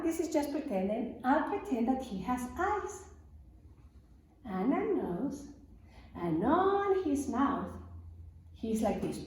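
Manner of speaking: ordinary talk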